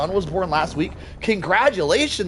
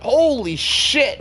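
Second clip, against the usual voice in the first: amazed exclamation; 6 semitones higher, much slower than usual. Real, not acted. Amazed at kill count.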